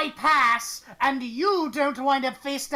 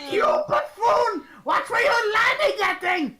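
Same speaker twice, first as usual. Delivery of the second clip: laughing shout; 8 semitones higher than usual. Acted. Mocking a friend who fell, laughing shout. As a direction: bellow it through laughter.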